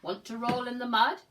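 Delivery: the pig calls to the spider, loud and eager; loud pig invitation. Acted. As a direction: call out the invitation. Pig invites spider, read aloud.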